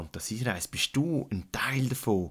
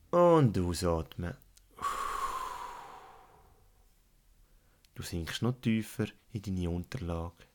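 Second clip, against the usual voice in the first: guided meditation. Acted. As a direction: lead a calm relaxation, unhurried. Guided relaxation fantasy journey.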